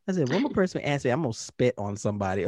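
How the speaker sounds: ordinary delivery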